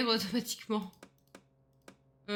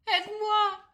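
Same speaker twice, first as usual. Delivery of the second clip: mock plea; 11 semitones higher, 5 dB louder, slower than usual. Real, not acted. Pleading with chat for help.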